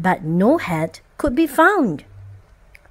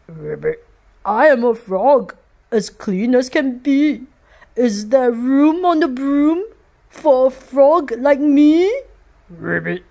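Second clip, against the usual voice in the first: croaking frog. Acted. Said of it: "then said with a croak".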